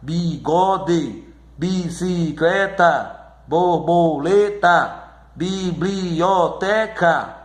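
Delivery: slow chanted word list, each syllable separate; even, measured beats with each word split up. Shown as a demonstration. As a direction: open the mouth afresh for every syllable. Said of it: "você divide a sílaba".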